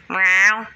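loud mock meow at the cat. Owner meowing loudly back at her cat in play. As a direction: belt out a playful meow.

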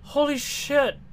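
high, loud startled yell; startled shout. Real, not acted. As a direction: cry out mid-sentence. Jumpscare interrupts greeting.

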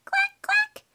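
tiny, very high-pitched quacks; baby duck quack. Pretend. Voicing the smaller duck who is just a baby, high duckling quack. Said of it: "She's just a baby".

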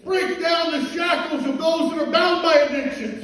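rising, loud pulpit preaching with a call for amen; fiery preaching. Acted. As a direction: preach it at full force to the church. Preaching, congregation answers.

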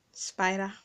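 ordinary talk

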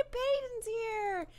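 happy squeal. Real, not acted. Delighted the guest has arrived.

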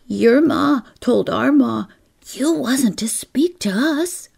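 put-on gasp, then high young-girl voice; gasping child. A narrator's reading. Lil tells Kezia they are forbidden, gasping first. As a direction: blurt it after a startled gasp. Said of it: "Lil gasped. Then she said".

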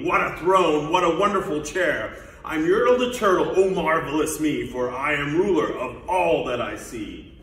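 loud, exultant royal boasting; shouting. Acted. Turtle king surveys all from his throne, shouting. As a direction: crow loudly over the whole kingdom. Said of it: "shouted Yertle".

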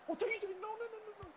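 very high, anguished pleading; panicked cry. Real, not acted. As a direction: beg in panic. Player blunders.

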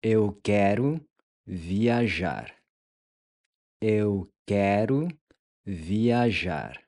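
"Eu quero" rising, pause, then "viajar." falling; broken into two parts for repeating; slow split phrase. Shown as a demonstration. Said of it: "How would we say". ("Eu quero", pause, "viajar." in two halves)

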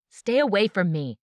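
loud, high yell. Acted. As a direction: yell it back over the shoulder. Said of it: "I yelled over my shoulder".

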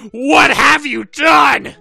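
very slow, high, booming shout; mock outraged shout. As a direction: bellow it in hammed-up horror. Neighbor's outburst over four kids.

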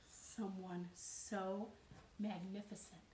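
plain reading voice

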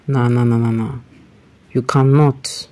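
usual speaking voice

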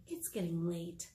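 hushed, secretive whisper; soft story whisper. Acted. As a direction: drop to a whisper for the kids. Acting out rhyme for kids.

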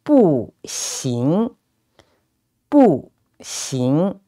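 "bu" falls, then "xing" rises; fourth tone, second tone. Shown as a demonstration. Teacher modelling "bu xing" for learners, falling then rising. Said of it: "or a second tone".

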